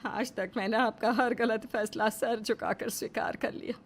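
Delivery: low, breathless half-whisper; mother-in-law quietly defying husband, whispered; hushed defiance. Acted. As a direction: hold the hurt down as you speak. Wife defies husband, pent-up.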